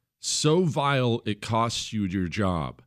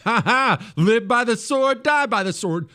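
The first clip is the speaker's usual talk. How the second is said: high, louder gloating laugh and jeer; mock gloating neighbor; 12 semitones higher, 4 dB louder than usual. Host mimicking his neighbor gloating online. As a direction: lampoon a smug neighbor cheering. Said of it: "That's how they talk".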